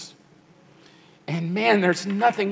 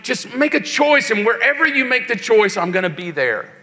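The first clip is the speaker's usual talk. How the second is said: high-pitched, insistent preaching plea; impassioned appeal; 4 semitones higher than usual. Acted. God opening his arms to young listeners, pleading insistently. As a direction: build the list in urgent repeated waves.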